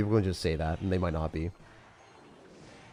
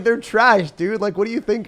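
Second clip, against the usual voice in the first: high, loud, exasperated rant; mock outrage; 13 semitones higher, 9 dB louder, slower than usual. Streamer complains viewers call his shorts trash, mock outraged. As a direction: let it burst out high and loud.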